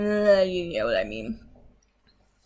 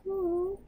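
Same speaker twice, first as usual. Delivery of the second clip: soft, high-pitched "aww"; touched "oh"; 11 semitones higher, 7 dB quieter than usual. Retold or imitated. Vlogger reacting to a friend's promise to fight, touched. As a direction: coo a small, high "oh". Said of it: "And I was like".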